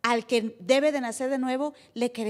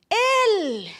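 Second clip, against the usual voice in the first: joyful exclaim; 8 semitones higher, 7 dB louder than usual. Real, not acted.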